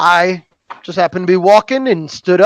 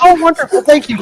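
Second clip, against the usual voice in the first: much higher and bright with delight; delighted elf lady; 11 semitones higher, 4 dB louder than usual. Acted. Elf woman delighted the player puts the bracelet on, gushing. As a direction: gush with pleasure, pitched up. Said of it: "Just put on the bracelet".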